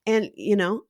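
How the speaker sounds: ordinary delivery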